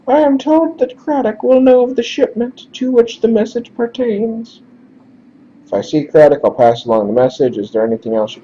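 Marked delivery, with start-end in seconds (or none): 0.1-4.6 formal falsetto explanation; high-pitched character voice. Acted. Messenger explains the shipment message, high-pitched voice. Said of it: "we're just gonna do high-pitched instead".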